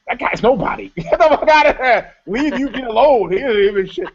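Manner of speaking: mocking spotter boyfriend. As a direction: scoff him off like a nobody.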